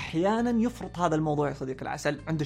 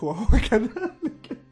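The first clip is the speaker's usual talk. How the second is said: slow, higher, laughing through the words; laughing agreement; 6 semitones higher, 5 dB louder, much slower than usual. Shown as a demonstration. Admitting yellow people are bad listeners, slow and laughing. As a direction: concede it while cracking up.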